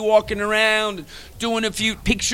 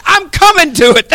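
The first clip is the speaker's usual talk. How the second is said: repeated louder and higher, punching coming; louder insistent repeat; 7 semitones higher, 9 dB louder than usual. Retold or imitated. Friend insisting on coming to the show, louder. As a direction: hammer it home he's coming no matter what. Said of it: "I says".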